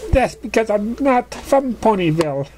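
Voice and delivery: put-on high, playful girl pony voice; giddy pegasus voice. Surprise telling Pinkie where she lives, high and playful. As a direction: answer with cheeky glee.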